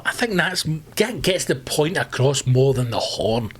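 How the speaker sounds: usual speaking voice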